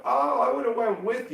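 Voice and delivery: high-pitched imagined pout; imitated high-voiced regret; mild mock disappointment. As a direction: voice her wistful little complaint up high. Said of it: "there'll be a little bit of".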